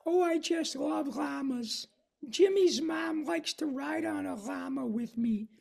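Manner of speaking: sing-song nerd savoring every L; Carl Wheezer voice. Acted. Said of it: "Carl says".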